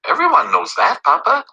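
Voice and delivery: put-on a clever child stating the obvious; lightly voiced little girl. A narrator's reading. Read to children: daughter answers her father, lightly childlike.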